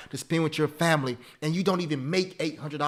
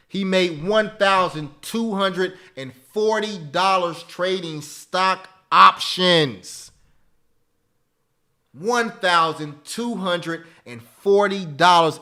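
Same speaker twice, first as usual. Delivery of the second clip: slow hype announcement; 3 semitones higher, 6 dB louder, slower than usual. Real, not acted. Announcing a big trading win.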